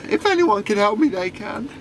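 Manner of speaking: high-pitched mock-weepy voice; acted forlorn little wail; tearful. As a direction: whimper it like a crying boy. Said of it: "like, crying and".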